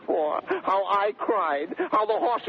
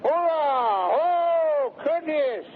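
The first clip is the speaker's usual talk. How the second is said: high, loud hail across the hall; calling out; 8 semitones higher, 3 dB louder, much slower than usual. Acted. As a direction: call for Curtis from the doorway. Calling out for Curtis.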